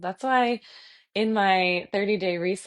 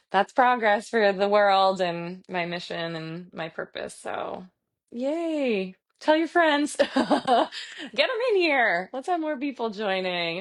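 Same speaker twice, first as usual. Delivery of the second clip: ordinary talk, nothing marked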